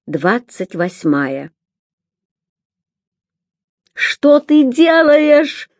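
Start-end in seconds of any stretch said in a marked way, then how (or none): 3.9-5.7 high, slow shouting question. A narrator's reading. Girl shouting at her brother to stop. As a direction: cry out at him in alarm. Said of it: "кричал Рен".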